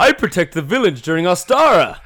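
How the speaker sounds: loud, high heroic declaration; weird character voice. Acted. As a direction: proclaim it in the odd protector's voice. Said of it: "his voice is weird".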